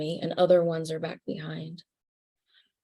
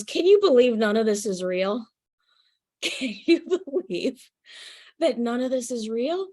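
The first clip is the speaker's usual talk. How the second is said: higher, giggling through the words; laughing grandfather; 5 semitones higher than usual. Retold or imitated. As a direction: laugh in delighted disbelief. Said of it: "he was laughing hysterically".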